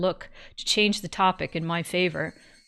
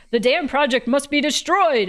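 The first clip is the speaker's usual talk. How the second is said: mock rallying shout; 6 semitones higher, 5 dB louder than usual. Narrated example of an incendiary shout.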